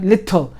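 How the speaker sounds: little with a crisp t; hitting both letters. Shown as a demonstration. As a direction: sound both parts of lit-tle. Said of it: "You're hitting the, the two letters".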